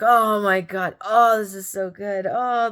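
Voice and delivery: retold moaning over delicious food; delighted food raving. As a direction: rave as if mid-bite. Acting delight while eating tacos.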